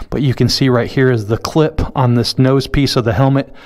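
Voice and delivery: close-miked through a helmet mic. Real, not acted. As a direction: talk right into a lapel mic at the lips. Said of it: "Hopefully it's not too close to my mouth".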